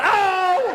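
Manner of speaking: sudden high, drawn-out ow; hammed-up cry of injury; mock pain yelp. Comic acting an injury while crossing the street, high pained yelp.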